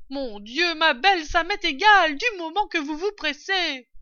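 high, loud, exasperated holler; impatient calling down. A narrator's reading. Mistress wanting her cider quickly, calling down impatiently. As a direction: hurry her along from upstairs.

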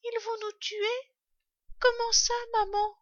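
put-on very high child's questioning voice; frightened fox cub. A narrator's reading. Fox cub asks his mother about danger, high and frightened. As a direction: ask like a scared little one. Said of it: "demanda l'un des renardeaux".

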